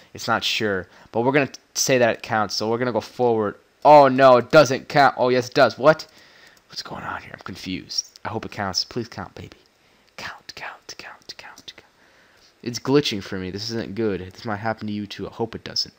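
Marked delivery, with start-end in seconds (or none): 3.8-6.1 loud, sudden oh no; startled gamer shout; dismayed outcry. Real, not acted. Reacts to game glitch. 8.8-11.9 whispered chant, very soft; will it to count under your breath; hushed begging to the screen; pleading whisper. Real, not acted.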